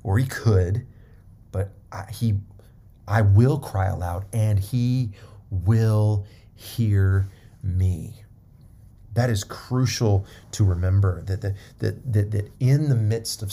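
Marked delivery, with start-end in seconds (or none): 3.1-8.2 "will" leaned on, the whole line slowed; stress on will. Shown as a demonstration. Preacher stressing the psalmist's certainty, slow stress on "will". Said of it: "Not he should, not he might".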